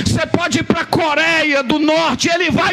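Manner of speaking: high, slow, shouted preaching; fiery preaching. Acted. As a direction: preach it out with full force. Preaching at full force. (pregação inflamada e lenta)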